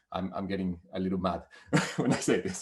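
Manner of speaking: low voice breaking into laughter; amused self-deprecation. Real, not acted. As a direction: laugh partway through admitting your irritation. Laughs at own rising anger.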